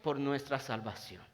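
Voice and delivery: impassioned Spanish sermon; fervent preaching. Acted. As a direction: preach it out, loud and driving. Preacher at full force.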